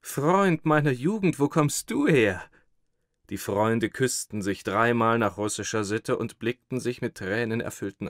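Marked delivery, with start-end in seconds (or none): 0.0-2.4 high and louder, full of wonder; can't believe his eyes; amazed. Acted. Thin man meeting his school friend, amazed and delighted. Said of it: "staunte der Dünne".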